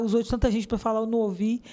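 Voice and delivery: ordinary talk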